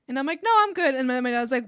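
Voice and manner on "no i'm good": loud, high firm no thanks; loud refusal. Retold or imitated. Refusing brothers' beer offer, loud and firm. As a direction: shut the offer down fast.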